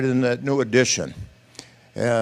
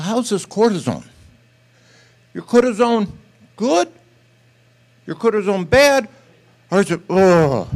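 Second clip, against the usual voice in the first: higher and louder, pushing for an answer; urgent pressing question; 8 semitones higher, 6 dB louder than usual. Retold or imitated. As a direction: insist he answer you, voice climbing. Pastor presses boy, repeating question.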